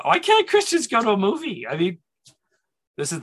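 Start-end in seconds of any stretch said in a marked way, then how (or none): 0.0-1.7 high-pitched, stammered mock protest; protesting question. Preacher recalling his objection to church rules, protesting. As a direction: push it way up, as a puzzled objection.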